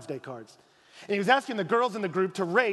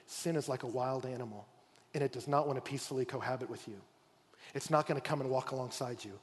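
quiet earnest warning; 6 semitones lower, 7 dB quieter than usual. Acted. God earnestly warning Cain.

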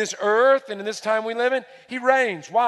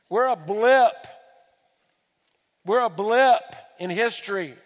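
"blip" punched louder; stress on blip; 5 dB louder than usual. Shown as a demonstration. Preacher belittling America's age to the congregation, emphatic. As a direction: hit "blip" hard. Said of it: "repeated phrase".

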